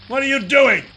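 alarmed high yell. Acted. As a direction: shout it in alarm. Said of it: "I yelled".

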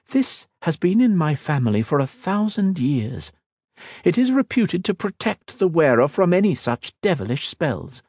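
high, poised royal voice, slowing at the end; a proud queen making a veiled threat; cool queen voice. Acted. The Queen shows her circlet.

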